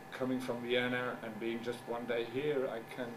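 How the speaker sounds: ordinary talk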